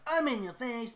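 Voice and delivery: high, loud hype voice; in your face. Retold or imitated. Imitating pushy YouTubers, high loud hype. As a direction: push it right at the listener. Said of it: "where they're like".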